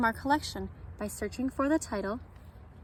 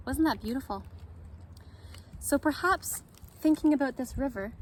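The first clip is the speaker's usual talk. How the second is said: ordinary delivery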